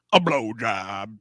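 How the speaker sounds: movie line imitation. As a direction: imitate the character's delivery, a little low. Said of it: "the way he fucking says it".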